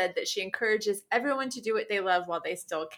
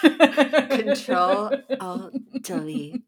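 robot voice; 5 dB louder, much slower than usual. Pretend. Playing a computer.

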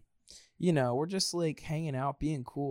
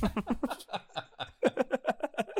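high, bursting laugh; laughing hard; real laugh; 11 semitones higher than usual. Real, not acted. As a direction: crack up at your own joke. Laughs at own joke.

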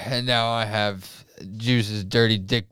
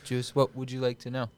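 ordinary talk

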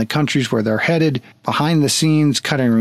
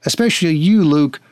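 ordinary talk, nothing marked

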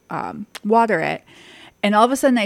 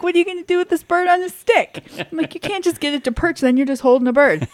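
exasperated disbelief; 9 semitones higher than usual. Retold or imitated. Exasperated retold questioning.

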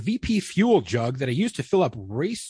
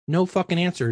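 usual speaking voice

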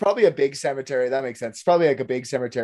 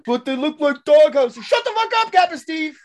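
mock shouting; 12 semitones higher, 3 dB louder than usual. Drunk then scolding.

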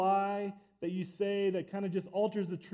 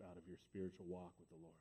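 hushed sermon; 13 semitones lower, 15 dB quieter than usual. Acted. Pastor warning the church softly about little sins, hushed. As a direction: sink the voice right down to a near hush. Preacher drops to hushed intensity.

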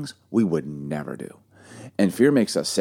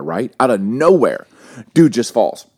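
normal talking voice

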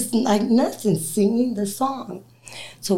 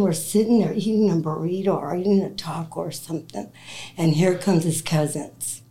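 ordinary talk, nothing marked

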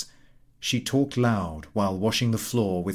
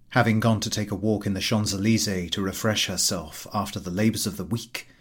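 ordinary delivery

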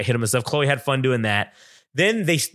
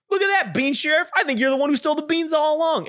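much higher, gleeful accusing voice; mock accusation; 13 semitones higher than usual. Granny guy framing the bean sheriff, in a high gleeful voice. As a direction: gotcha tone, lightly hammed.

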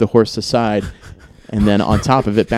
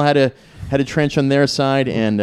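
normal talking voice

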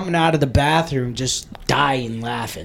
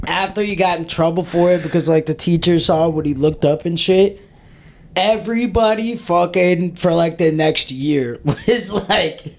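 ordinary talk, nothing marked

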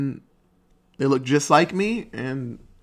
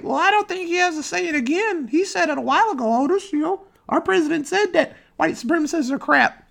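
high-pitched imitation of an apologist; squeaky mocking defense; mock defensive supporter. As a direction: protest in a silly, way-up-high voice. Mimics defenders of the president.

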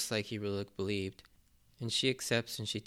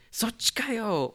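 much higher, slow mock yell; imitating Sanji's yell; 12 semitones higher, 4 dB louder, slower than usual. Sanji breaking the fourth wall at Luffy, high mock yell. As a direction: yell it at the camera in disbelief. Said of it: "turns to the camera and yells".

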